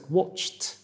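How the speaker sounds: "Watched" with a t ending; "Watched" said as "watcht". Shown as a demonstration. Teacher repeats "Watched" with a t ending. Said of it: "ćemo izgovoriti kao T".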